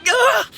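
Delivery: high startled scream; short, high yelp of terror; frightened yelp. Acted. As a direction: cry out in sudden fright. Man startled by supposed ghost.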